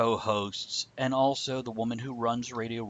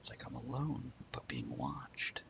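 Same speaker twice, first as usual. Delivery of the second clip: spooky whisper; 13 dB quieter than usual. Pretend. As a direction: breathe it like a ghost story. Eerie joke about being alone.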